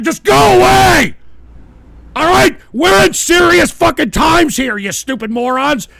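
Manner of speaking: exasperated scolding at full voice; furious yelling. Real, not acted. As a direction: yell at the trolls to leave. Yells at trolls to leave.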